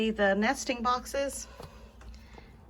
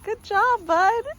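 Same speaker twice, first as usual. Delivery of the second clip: laughing praise; 11 semitones higher, 8 dB louder, slower than usual. Real, not acted. Delighted praise for the dog.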